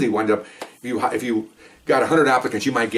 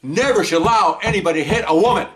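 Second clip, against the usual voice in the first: high, emphatic mock outburst; imitated outburst; 9 semitones higher, 4 dB louder than usual. Candidate exploding in front of interviewers, high and emphatic. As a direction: proclaim it high and forcefully. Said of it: "And she goes".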